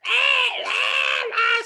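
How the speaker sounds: high screeching cat yowl. Retold or imitated. Storyteller imitating the scrubbed cat's yowl, high and loud. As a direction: screech it like a cat in soapy water. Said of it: "squalling and howling and yowling".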